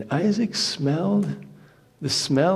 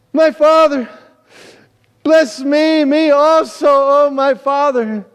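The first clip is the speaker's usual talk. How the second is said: loud, high anguished pleading; bitter cry; 12 semitones higher, 11 dB louder, slower than usual. Acted. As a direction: cry out to father, begging desperately. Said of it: "cry out with an exceedingly great and bitter cry".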